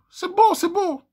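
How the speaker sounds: gushing high-pitched admiration; swooning high praise; high delighted praise. Real, not acted. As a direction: coo it high and bright with delight. Praising the romantic line.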